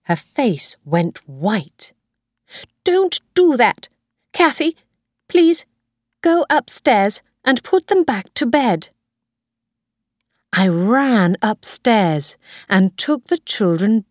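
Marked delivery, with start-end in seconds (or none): 2.8-8.9 high-pitched urgent orders; alarmed Mrs Harvey. A narrator's reading. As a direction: order them to bed, pitch raised. Alarmed grandmother gives orders.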